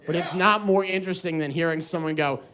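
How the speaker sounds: normal talking voice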